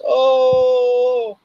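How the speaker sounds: sudden high exclaim; delighted oh. Acted. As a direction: burst out with a high, pleased oh. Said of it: "he goes".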